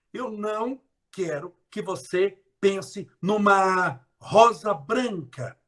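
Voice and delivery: slow, deliberate suggestion; slow demo. Shown as a demonstration. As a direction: spell it out slowly. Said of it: "O que é que vem na sua mente?". (devagar e pausado)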